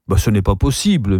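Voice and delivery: usual speaking voice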